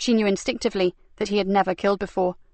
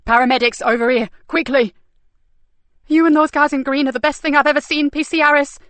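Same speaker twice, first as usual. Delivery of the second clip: urgent calling; 5 semitones higher, 5 dB louder than usual. Acted. Calling for paramedics.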